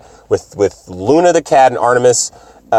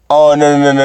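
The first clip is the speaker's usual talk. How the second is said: ordinary talk, nothing marked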